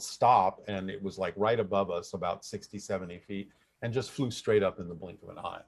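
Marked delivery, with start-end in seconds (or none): 0.0-0.6 louder, brief mock yell; imitated yell, not full force; retold yell. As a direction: call it out like a passenger's sudden warning. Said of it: "she's yelled".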